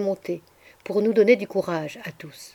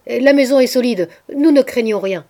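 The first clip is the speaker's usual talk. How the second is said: loud, raised urgent call; shouted; 5 semitones higher, 8 dB louder than usual. A narrator's reading. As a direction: shout to rally the others. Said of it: "criai-je".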